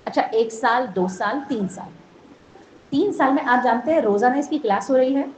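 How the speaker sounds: ordinary talk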